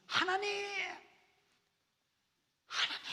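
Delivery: high, loud calling out to God; crying out. Acted. Preacher voicing Jabez crying out to God, high and loud. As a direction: call out to God desperately. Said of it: "cried out이라 그랬어요".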